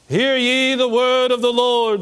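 slow, booming prophet proclamation. Shown as a demonstration. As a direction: proclaim it like an Old Testament prophet. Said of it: "What would a prophet sound like to you? Let's try".

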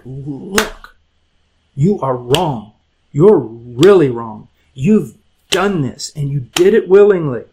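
slow, weighted words of rebuke; scolding. Shown as a demonstration. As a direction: scold them, drawing out each accusation. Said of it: "the word is scold".

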